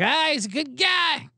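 fake-polite cheering for the new coach; mock cheery pundits. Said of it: "they're all like".

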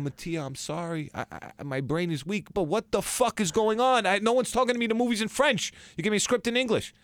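high, rising panicked pleading; panicked plea. Retold or imitated. Begging the director after a panic attack, high and panicked. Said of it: "I'm hysterical crying".

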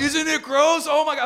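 high, loud imitation of grossed-out friends; mock shock. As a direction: squeal it like appalled drinking buddies. Mimicking friends' reaction to Malort.

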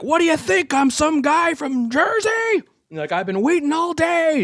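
high-pitched mimic of a furious rant; angry rant. Host ranting at the late crew, high mock fury. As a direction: rant it like he's spitting in your face.

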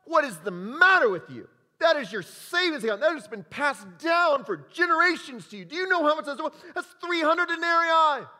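high, loud indignant scolding; acted scolding. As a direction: berate her for the waste. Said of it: "They are scolding her".

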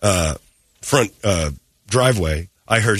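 ordinary delivery

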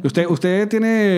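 "usted, usted tiene" very slow and hesitant; doubtful tone. Shown as a demonstration. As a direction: stretch "tiene" out as if unsure. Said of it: "Intente preguntarme hablando en duda".

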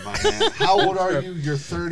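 teasing. Real, not acted. Teasing friend about energy drinks.

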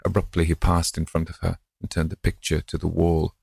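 usual speaking voice

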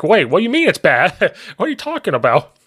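high-pitched, playfully incredulous questioning; mock disbelief. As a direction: act baffled by the verdict, half laughing. Acting out offended husband's disbelief.

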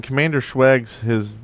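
ordinary talk, nothing marked

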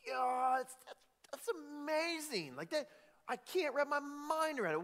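high, amazed exclamation; awestruck. Retold or imitated. Voicing awe of the listener.

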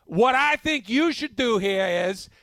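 hammed-up Boston accent, pitching hard; mock Boston accent. Coach recruiting a Boston receiver, in a mock Boston accent. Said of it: "wide receiver out of Boston".

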